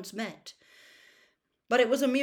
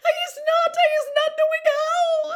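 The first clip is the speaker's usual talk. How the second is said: very high, loud, tearful elf squeal; frantic squeal; 14 dB louder than usual. A narrator's reading. Accused house-elf denying she used wands, squealing. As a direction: shriek the denials in panic. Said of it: "she squealed, her eyes rolling in terror".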